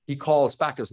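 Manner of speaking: ordinary delivery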